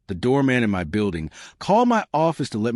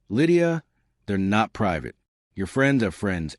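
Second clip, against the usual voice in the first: plain reading voice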